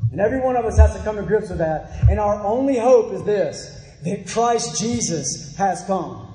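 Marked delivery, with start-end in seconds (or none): none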